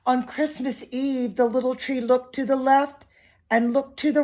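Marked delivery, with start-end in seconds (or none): none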